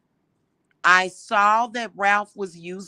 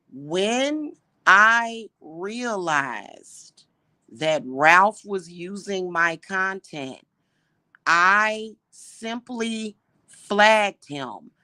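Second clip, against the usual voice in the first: words drawn out slowly, each one spelled out; slow it way down so they finally get it; slower, deliberate; much slower than usual. Shown as a demonstration. Repeating herself slowly to viewers who accused her. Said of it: "I'm gonna say it again, but slower".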